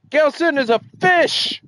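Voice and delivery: excited. Acted. Hammed-up reading of fish entry.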